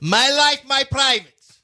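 put-on high, loud Slavic-accented outburst; thick mock Russian accent, pitched high and indignant; Darko. Sports coach protesting a foul, in a high mock Russian accent. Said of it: "Your Darko impression is".

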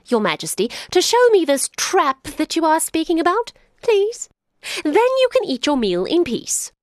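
sly jackal. Acted. Jackal pretending to leave the lion and boy, high and chirpy. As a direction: play innocent and fluttery.

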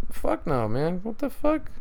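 sudden high-pitched disbelief; incredulous. Real, not acted. Vlogger reacts to America giving up, in shrill disbelief. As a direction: squeak out the disbelief. Exasperated outburst in rant.

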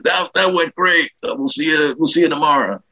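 lightly voiced approving boss, pitched high; George Carlin. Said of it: "So he goes".

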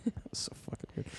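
fast hushed whisper. Real, not acted. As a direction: whisper it quickly. Marveling about sleep talk.

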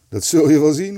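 a touch of a laugh in it, spoken up; amused. A narrator's reading. Kees teasing his two friends about his plan, amused. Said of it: "lachte Kees".

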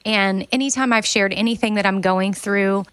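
ordinary delivery

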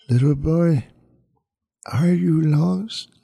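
high, slow, muffled woman's voice. Acted. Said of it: "said in an oddly muffled voice".

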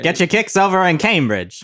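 put-on old-timey announcer. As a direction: sell it like a 1940s radio ad. Joining the announcer bit.

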